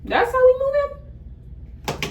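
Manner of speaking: an offended "oh really?" climbing high; shocked, high and slow. Retold or imitated. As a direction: pitch shoots way up, stretched out in disbelief. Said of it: "I'm like".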